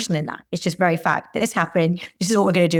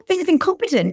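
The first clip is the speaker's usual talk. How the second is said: bullying; 9 semitones higher than usual. Retold or imitated. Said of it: "a bullying".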